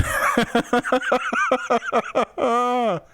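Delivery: high amused laugh; long high laugh; real laugh. Real, not acted. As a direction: crack up at the joke. Laughing at viewer comment.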